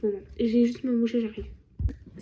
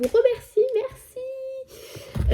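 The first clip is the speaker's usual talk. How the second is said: very high sing-song thanks; squeaky thank-you; 13 semitones higher, much slower than usual. Pretend. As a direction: chirp it up high and cute. Remerciement chanté aigu. (merci aigu et chantant)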